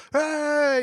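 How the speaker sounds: high, loud gambler's whoop; excited cheer. Retold or imitated. Crowd at a lucky craps table, excited whoop. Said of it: "people are all excited".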